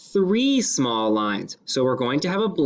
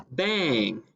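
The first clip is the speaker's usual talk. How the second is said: usual speaking voice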